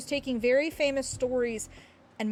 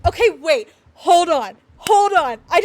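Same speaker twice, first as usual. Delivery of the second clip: excited; 4 semitones higher, 10 dB louder than usual. Real, not acted. Excited sudden idea.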